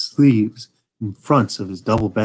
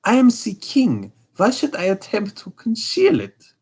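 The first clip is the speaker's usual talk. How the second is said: king's voice pitched much higher; high, desperate royal outburst; king crying out; 12 semitones higher than usual. Acted. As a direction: throw off the disguise with a cry. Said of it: "he cried".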